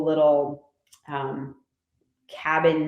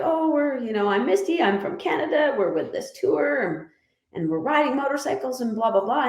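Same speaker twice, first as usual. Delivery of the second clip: own bright chatter; 7 semitones higher than usual. Retold or imitated. Self quoted chatting to stranger.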